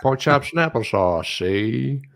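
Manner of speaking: slow, lightly voiced kid playing a gangster accent; a boy putting on a mock tough-guy accent; Bobby Brady gangster. Host retells the Brady Bunch episode, mock gangster accent. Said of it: "thought he was one of those guys".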